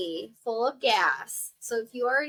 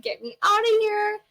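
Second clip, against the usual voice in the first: high, louder whine to be let out; hammed-up drugged patient begging to leave; mock whiny demand; 9 semitones higher, 5 dB louder than usual. Loopy after anesthesia, demanding.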